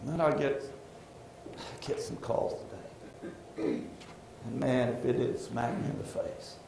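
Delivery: low, hushed, weighed-down confession; subdued and halting, softly confiding; heavy-hearted. Real, not acted. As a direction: let the voice sink under a burden. Confesses a heaviness on him.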